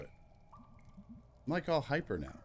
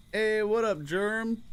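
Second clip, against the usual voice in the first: loud, high greeting; calling out; 13 semitones higher, 6 dB louder, slower than usual. Acted. Greeting a friend from down in the well, a loud high call.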